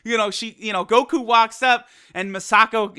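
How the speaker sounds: ordinary talk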